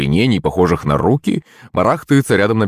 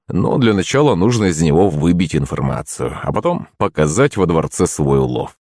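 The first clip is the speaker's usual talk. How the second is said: ordinary delivery, nothing marked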